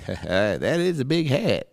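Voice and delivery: badly faked celebrity voice, drawn right out. Judge commenting on a contestant's hat, slow amateur impression. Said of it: "then they'll cut to [name]".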